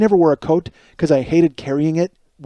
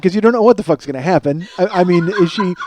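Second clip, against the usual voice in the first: ordinary talk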